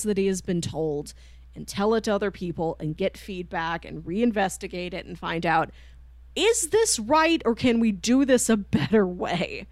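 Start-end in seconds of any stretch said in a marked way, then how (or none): none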